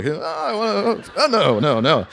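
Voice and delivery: high, lightly voiced self-correction; a hint of panicked backpedalling; mock catching himself. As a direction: catch yourself mid-wish. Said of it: "Oh, fuck, keep it real".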